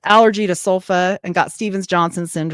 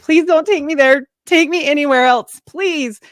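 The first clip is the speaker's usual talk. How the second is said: pleading; 8 semitones higher, 4 dB louder than usual. Retold or imitated. Begging paramedics, brother died there.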